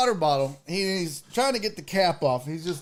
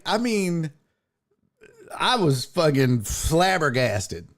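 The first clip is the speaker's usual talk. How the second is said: ordinary talk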